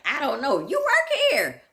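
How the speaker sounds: mock disbelief at a clueless clerk; incredulous squeak. At the worker who knows nothing, a shrill incredulous jab. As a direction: pitch leaps way up on "You work here". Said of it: "And you're like".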